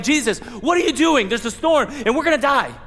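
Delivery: mock-frightened disciples waking Jesus in the storm; panicked disciples. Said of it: "they're like".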